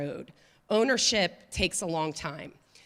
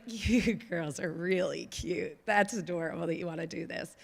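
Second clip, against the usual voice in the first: condescending; 4 dB quieter than usual. Retold or imitated. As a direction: talk down to them like little girls. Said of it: "everybody was like".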